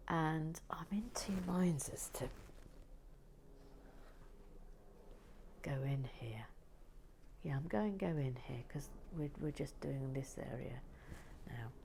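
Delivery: soft whispered aside; whispering. Real, not acted. Whispering about where to place the piece. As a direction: keep it hushed. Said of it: "whispered, a real aside".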